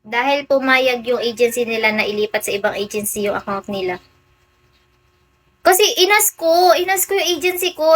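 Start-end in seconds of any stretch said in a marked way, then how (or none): 5.6-7.9 agitated complaint. Real, not acted. Agitated complaint about agency.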